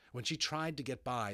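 ordinary delivery

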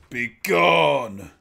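loud booming banishment; villain command. Retold or imitated. Villain casting the hero away, loud and booming. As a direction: blast the words out like a spell. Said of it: "And the villain just goes".

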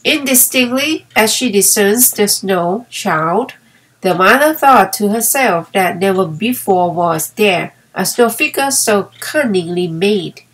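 usual speaking voice

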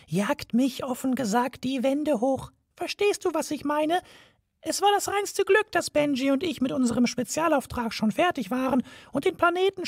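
high, tiny mouse voice, fed up; a mouse sick of the whole affair; high mouse voice, candid and weary. A narrator's reading.

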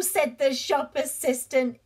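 usual speaking voice

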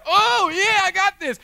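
high, booming mock-heroic cry; over-the-top pumped-up yell; exultant out-loud shout. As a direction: shout it like an overexcited hero. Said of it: "out loud it was like".

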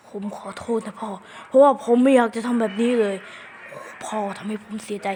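unvoiced, hushed apology; whisper. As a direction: whisper the sorry to dad. Child character apologising in secret. (กระซิบขอโทษพ่อเบาๆ)

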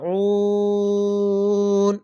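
ইয়াজমাউন chanted very slowly, the final উ stretched long; slow recited verse ending; three-alif stop. Shown as a demonstration. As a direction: draw the last vowel of ইয়াজমাউন out. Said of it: "তিন আলিফ টেনে পড়বেন".

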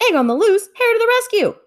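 high, loud heroic call; rally to the rescue; excited shout. A narrator's reading. Hare racing after the runaway egg, excited shout.

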